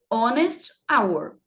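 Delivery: "Honest hour" said slowly, no h sound, opening on the vowel; silent h. Shown as a demonstration. Teacher showing the silent h, slow and clear. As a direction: drop the h, start on the vowel, slow. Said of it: "porque o H é mudo".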